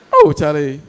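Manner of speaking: ordinary talk, nothing marked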